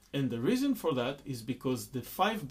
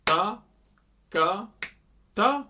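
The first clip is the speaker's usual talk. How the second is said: ta lifted much higher, light upbeat after Ta ka; last ta as upbeat; 6 semitones higher, 8 dB louder, slower than usual. Shown as a demonstration. Teacher showing ta as an upbeat. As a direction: flick the last ta up high. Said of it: "will feel like an upbeat".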